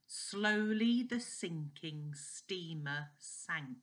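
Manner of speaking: slow, deliberate "Slowly the sinking steamer sank"; really slowly. Shown as a demonstration. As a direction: draw out every word. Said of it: "I'll say that really slowly for you".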